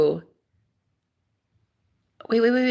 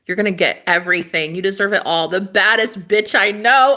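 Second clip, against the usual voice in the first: ordinary delivery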